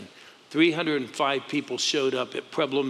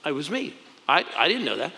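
ordinary talk, nothing marked

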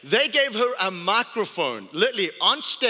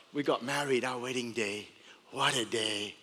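hushed; 9 semitones lower, 10 dB quieter than usual. Acted. Tender wedding memory.

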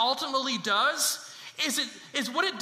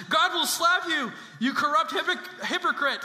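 higher, louder shout breaking into laughter; raised-voice mock denunciation with a laugh; gleeful rebuke; 6 semitones higher, 4 dB louder than usual. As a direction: hurl the insult, half laughing. Acting Paul's rebuke of high priest.

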